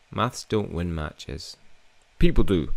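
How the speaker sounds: slow, deliberate character line; slow, dismissive character voice; slow retort. Acted. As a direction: drag each word out firmly. Voices teammate retort again.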